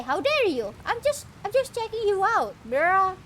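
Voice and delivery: a hint of an affronted character; sassy character. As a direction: bristle with mock offence. Voicing NPC.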